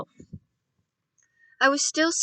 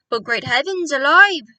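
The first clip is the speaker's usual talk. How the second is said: higher, much louder outraged cry; furious shout; 4 semitones higher, 11 dB louder than usual. Acted. Doctor shouting in fury about the trap. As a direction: explode in fury. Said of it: "the doctor shouted".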